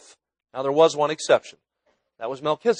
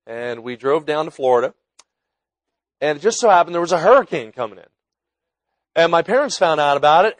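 preaching; 5 dB louder than usual. Acted. Sermon delivered at full force.

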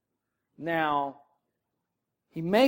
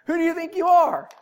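higher, louder mock indignation; incredulous challenge; 9 semitones higher, 5 dB louder than usual. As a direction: throw the question back like an offended Pharisee. Mimicking Pharisees challenging Jesus.

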